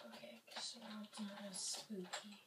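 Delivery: hushed whisper to himself; whispered relief. Real, not acted. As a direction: drop to a whisper, as if it's spooky. Quiet aside in dark game.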